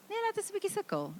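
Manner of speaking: high, fast Afrikaans dad line; dad's voice. Retold or imitated. Dad telling mom to let the child struggle, fast and high. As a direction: toss it off quickly like an unbothered dad. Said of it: "Dads go".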